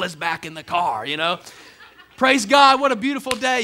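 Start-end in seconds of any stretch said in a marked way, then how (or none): 2.2-3.6 loud, high sing-song piety; churchy cheer. Pretend. Churchgoer after service cheers God in the car, loud piety. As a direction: put on the Sunday smile, loudly.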